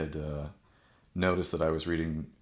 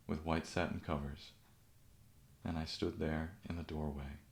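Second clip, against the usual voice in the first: soft, measured recital with long pauses; quiet poem reading, fading gently to the end; poetry reading; 5 dB quieter than usual. Acted. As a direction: read verse line by line, letting each settle. Reads a poem aloud.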